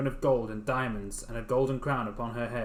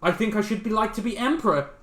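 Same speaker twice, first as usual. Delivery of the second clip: greedy wife voice; 8 semitones higher, 5 dB louder than usual. Acted. Wife voiced demanding more.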